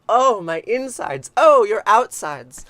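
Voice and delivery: slow, loud hammed-up moaning; mock moan. Retelling the beings' moan, a slow loud mock moan. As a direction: ham up the passionate groan.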